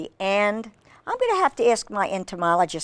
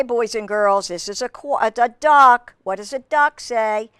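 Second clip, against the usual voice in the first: ordinary delivery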